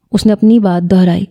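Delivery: plain reading voice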